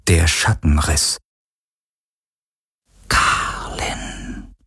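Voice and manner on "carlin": soft longing whisper. Acted. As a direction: breathe the name yearningly. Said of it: "flüsterte er". (sehnsüchtig geflüstert)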